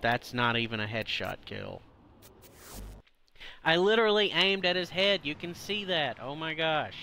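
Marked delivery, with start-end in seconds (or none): 3.6-5.2 louder, higher and fast, fed up; exasperated outburst. Real, not acted. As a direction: snap it out at the screen. Frustrated gamer complaint.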